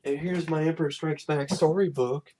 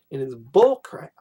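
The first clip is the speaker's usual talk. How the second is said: ordinary delivery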